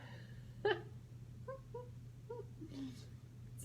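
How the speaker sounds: breathless giggle; laughing. Real, not acted. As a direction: silent shaking laugh.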